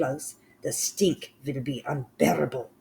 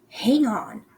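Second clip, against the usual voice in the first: high, raised call; crying out; 9 semitones higher, 5 dB louder than usual. Acted. Mildred stops the witches, crying out. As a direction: stop them with a sharp cry. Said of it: "cried the mitch called Mildred".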